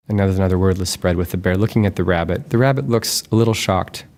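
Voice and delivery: ordinary delivery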